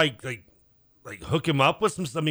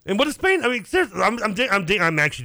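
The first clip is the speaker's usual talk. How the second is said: excited disbelief; 9 semitones higher, 4 dB louder than usual. Real, not acted. Protests he's serious, high and loud.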